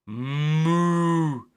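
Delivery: a mooing cow; gentle moo. A narrator's reading. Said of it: "mooed the gentle cows".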